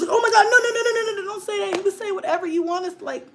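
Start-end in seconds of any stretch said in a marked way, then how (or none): 0.0-1.8 high, frantic no no no; hammed-up horrified parent; mock panic. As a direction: pretend freakout at a kid.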